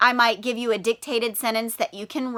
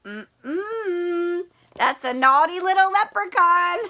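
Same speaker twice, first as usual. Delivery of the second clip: playful scolding; 5 semitones higher, slower than usual. Acted. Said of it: "teacher scolds leprechaun playfully".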